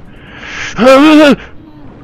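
sharp loud frightened gasp; startled gasp. Real, not acted. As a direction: gasp in sudden terror at a shark. Shark appears suddenly in game.

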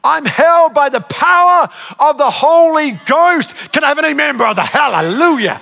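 loud, drawn-out proclamation; rally the church for an amen; revival preaching. Real, not acted. Preaches at full force.

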